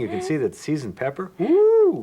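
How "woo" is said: excited whoop. Real, not acted. Seeing seasoning, cheerful exclamation.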